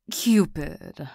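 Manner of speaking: normal talking voice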